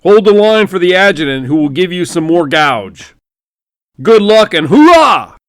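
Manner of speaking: loud, high hearty bellow ending in a shout; a blustering general barking orders like Foghorn Leghorn; booming general. Acted. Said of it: "Foghorn Leghorn".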